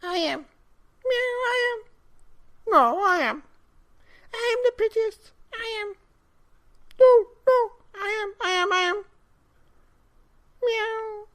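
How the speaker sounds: very high, loud squeaking cats; each cat crying out, very high and loud; squeaky cat voices. Acted. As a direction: squeal it like a crowd of bickering cats. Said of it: "cried hundreds and thousands ... of voices".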